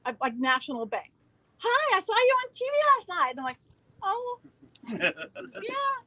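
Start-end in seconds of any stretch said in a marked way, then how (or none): 1.6-3.3 high, loud chirpy caller; gushing client. Retold or imitated. Biggest corporate client phoning about the TV show, gushing. 4.0-6.0 high, nervous little reply; voice getting higher. Retold or imitated. Caught out by her corporate client, voice going high. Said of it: "My voice gets higher".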